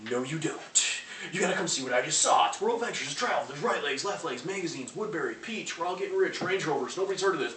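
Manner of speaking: high, loud, breathless rush of hype; overexcited pitch. Retold or imitated. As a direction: gush about it like you can't hold it in. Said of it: "I used to be so excited in the beginning".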